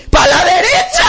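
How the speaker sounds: high, laughing mock shout; comic triumphant cry. Driver obeying the friend's wrong directions, comic cry. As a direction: cry it out with rising pitch.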